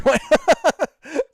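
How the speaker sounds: high, loud burst of laughter; loud giggling fit. Real, not acted. Laughs at the impression request.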